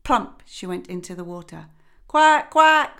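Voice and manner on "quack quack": loud, high duck call; louder, higher quacking cry. Acted. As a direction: call the ducklings like a mother duck. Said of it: "she cried".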